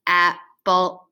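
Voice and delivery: "Apple" in two mechanical, level beats; robot voice. Shown as a demonstration. Teacher plays robot for pupils, "Apple" in robot voice. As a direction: say "Apple" like a robot, parts disconnected. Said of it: "now let's be a robot".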